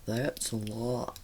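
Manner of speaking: slow, low imitation of husband's heavy accent; mock husband's accent, vowels stretched long; husband's drawling accent. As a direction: drag it out deep and slow in his accent. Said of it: "my husband does talk like that".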